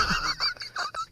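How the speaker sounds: airy high giggle; breathless laugh. Real, not acted. Speaker laughing at being terrified, airy giggle.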